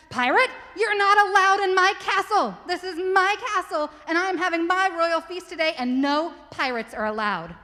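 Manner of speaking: high, loud haughty royal scolding; a prince shouting at a pirate from his doorway; indignant prince. Acted. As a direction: order the pirate off like a stuck-up prince. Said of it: "and he said".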